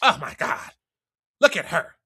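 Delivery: put-on catty hater girl. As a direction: sneer like a jealous girl in the corner. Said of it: "another little crew of females be mad".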